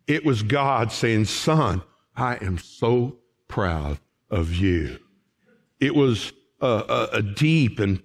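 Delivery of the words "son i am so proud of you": low, slow, tender father's voice; tender fatherly pride. Retold or imitated. God speaking to his Son.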